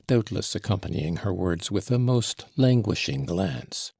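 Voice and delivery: ordinary delivery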